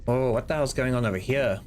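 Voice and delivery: ordinary talk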